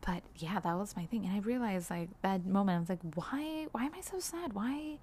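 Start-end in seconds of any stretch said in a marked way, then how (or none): 3.2-5.0 despairing why. Retold or imitated. Despairing self-question while crying.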